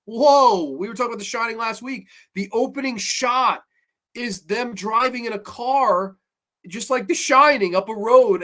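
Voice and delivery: ordinary talk, nothing marked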